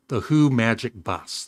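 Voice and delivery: ordinary delivery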